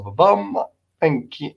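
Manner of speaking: ordinary talk, nothing marked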